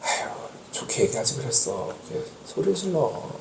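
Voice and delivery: soft, hushed reproach; gentle wife chiding. Retold or imitated. Wife softly chiding her husband after his yelling, quiet reproach. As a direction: coax him to speak nicely, softly. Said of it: "우리 아내가 보통 이렇게 얘기하죠".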